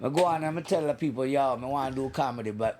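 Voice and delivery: light Jamaican patois; Jamaican accent. Pretend. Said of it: "in a Jamaican accent".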